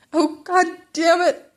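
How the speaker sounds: high, loud, drawn-out exasperation; frustrated curse. Acted. Izuku curses his luck at the pairing, loud and high. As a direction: groan it out, dismayed.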